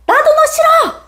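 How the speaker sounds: very high, petulant mock retort; childish mom. Mom fighting back at her child, high childish retort. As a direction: throw it back like a squabbling kid. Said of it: "아이랑 싸우는 엄마도 봤어".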